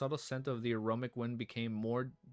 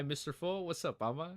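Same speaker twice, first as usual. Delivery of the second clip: ordinary talk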